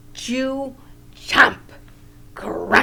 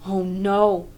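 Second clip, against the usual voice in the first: ordinary delivery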